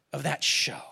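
breathy hushed whisper, barely voiced; whispered aside. Real, not acted. Preacher confides to the congregation in a hushed whisper. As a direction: let it drop to a secret whisper. Aside about the show's content.

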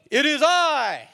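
loud, high, hammed-up announcement; mock grand reveal. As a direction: proclaim it like an overblown reveal. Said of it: "Jesus doesn't go".

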